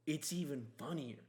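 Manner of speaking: ordinary talk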